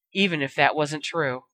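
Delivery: ordinary delivery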